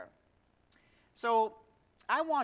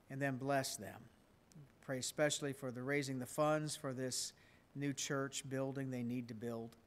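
soft, steady prayer voice; 4 semitones lower, 6 dB quieter than usual. Acted. Pastor praying for missionaries, softly. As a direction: pray quietly on behalf of the church.

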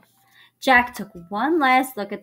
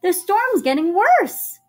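higher, louder, rising urgency; urgent plea; 8 semitones higher, 6 dB louder than usual. A narrator's reading. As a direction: press them to leave at once. Annie urges Jack to leave.

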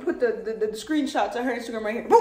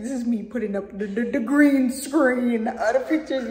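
normal talking voice

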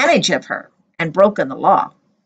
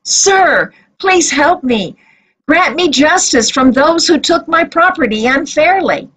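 higher voice, begging the judge; pleading widow; 6 semitones higher than usual. A narrator's reading. Widow begging the judge to hear her, pleading. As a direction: plead with the judge for your rights. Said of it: "the widow came again to the judge".

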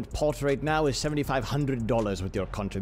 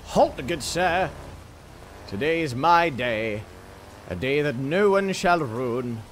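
put-on a lightly pompous old-timey gent; a hint of a pompous aristocrat; grand gentleman; 6 semitones higher, 6 dB louder, slower than usual. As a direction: announce yourself grandly. Grand self-introduction as Jacques Pot.

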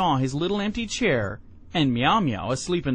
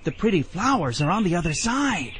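lightly voiced luring snake, a bit higher; coaxing snake; 4 semitones higher than usual. A narrator's reading. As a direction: tempt the child onward.

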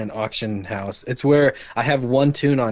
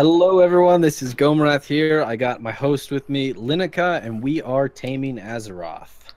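loud, upbeat show opener; podcast host intro; 4 semitones higher, 5 dB louder than usual. Acted. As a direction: announce the show to your audience.

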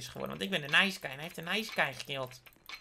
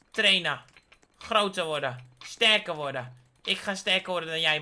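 normal talking voice

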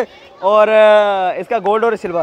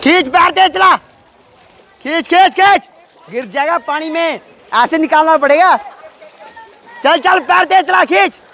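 cheering on; 7 semitones higher than usual. Real, not acted. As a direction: yell Chintu down the pool. Cheering Chintu in race.

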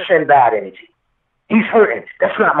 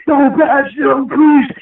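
pleading wife; 7 semitones higher, much slower than usual. Retold or imitated. Wife collapsing, begging at ICU.

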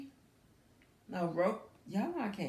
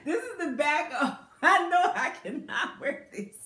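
loud laughing, higher pitch; laughing hard; 7 semitones higher, 5 dB louder than usual. Real, not acted. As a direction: laugh through it in disbelief. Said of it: "I'm laughing so hard because".

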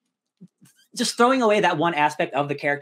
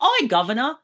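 higher-pitched caricature Cockney call; 10 semitones higher than usual. Retold or imitated. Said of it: "like, Cockney".

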